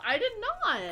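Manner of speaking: indignant, high-pitched denial; high-pitched protest. Real, not acted. Protests accusation of a slur.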